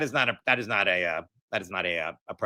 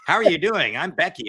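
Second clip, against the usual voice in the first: higher mock sing-song receptionist voice; fake cheery phone bot; 7 semitones higher, 4 dB louder than usual. Guest imitating a virtual phone agent pretending to be real. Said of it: "they pretend that they're real".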